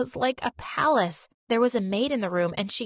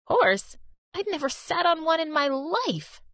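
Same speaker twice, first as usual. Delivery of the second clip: higher, disbelieving protest; incredulous; 7 semitones higher than usual. Acted. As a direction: blurt it out in disbelief. Disbelief at the doctor's claim.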